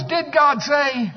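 a slow, mocking devil asking; devil's taunt. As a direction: draw it out like the devil sowing doubt. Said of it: "And the devil goes".

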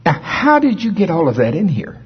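imitating elderly mother. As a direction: lift the pitch and sound amazed like his old mom. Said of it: "she'll take that phone and she'll go".

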